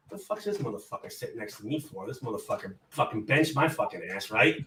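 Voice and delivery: low, quiet grumble under the breath; muttered inner thought. Retold or imitated. Benched player thinking about the coach beside him, low mutter.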